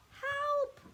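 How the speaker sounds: very high, loud scream for help. A narrator's reading. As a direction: shriek it out in terror. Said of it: "screamed Missus Twit".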